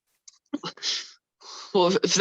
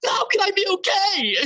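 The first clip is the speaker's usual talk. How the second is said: high-pitched, frantic outburst; distraught shout; 9 semitones higher than usual. Acted. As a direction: cry out in despair. He breaks down pleading.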